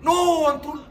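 loud, high yell of frustration; frustrated shout. Real, not acted. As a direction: yell at the player in dismay. Missed chance, yelling at player.

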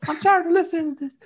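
very high, fast mock doll voice. Doll complaining about the long-winded waiter, very high fast mock voice. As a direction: rattle it off in a tiny high doll voice. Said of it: "grab her arm and be like".

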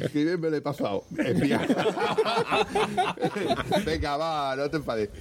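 ordinary talk, nothing marked

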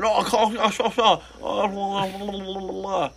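high, far-off mock Russian babble; high mock-Russian blah-blah; mock Russian gibberish.

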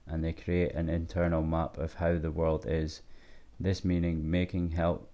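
quiet, even reading voice; reading aloud. Acted. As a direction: read the passage out steadily. Reads a passage aloud.

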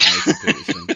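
teasing full-name call in a sudden squeaky high voice; mock scolding. Real, not acted. Teasing his co-host as a giant child, squeaky high mock scolding.

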